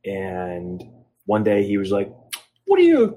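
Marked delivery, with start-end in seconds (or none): 2.6-3.1 imitating a thick Italian accent, much higher and louder. Quoting his Italian trainer naming him, mock Italian accent. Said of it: "in his thick Italian accent that I'm gonna butcher".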